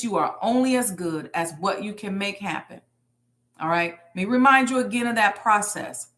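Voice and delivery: ordinary delivery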